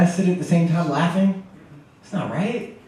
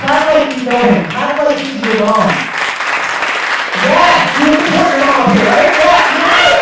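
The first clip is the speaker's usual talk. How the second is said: loud hype-man call to the crowd; 8 semitones higher, 5 dB louder than usual. Real, not acted. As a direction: pump up the room loudly. Host hyping crowd to clap.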